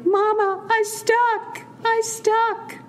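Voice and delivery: imitated little child, very high voice; yelling toddler. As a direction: call for mama like a stuck two-year-old. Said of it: "she was stuck there yelling".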